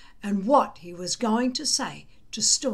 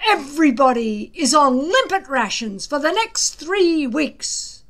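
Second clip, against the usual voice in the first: stern punishment; 5 semitones higher, 6 dB louder than usual. A narrator's reading. Gobber punishing the boys.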